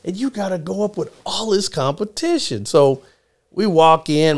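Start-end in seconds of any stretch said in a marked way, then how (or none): 0.1-2.6 worried mom. Retold or imitated. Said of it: "my mom was like".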